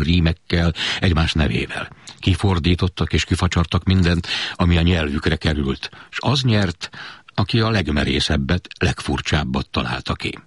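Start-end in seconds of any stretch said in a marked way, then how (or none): none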